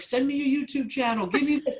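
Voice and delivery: high-pitched, keen mock neighbor; eager imitation. Retelling the neighbor wanting the channel, eager imitation. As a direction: imitate someone who is all about it. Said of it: "but he was all about it".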